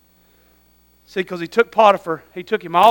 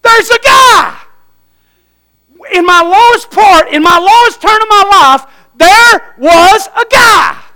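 much higher, loud shouted remembering; excited shout; 13 semitones higher, 10 dB louder, slower than usual. Retold or imitated. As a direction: burst out with sudden recall. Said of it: "that butler goes".

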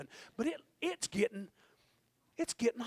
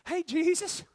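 ordinary talk

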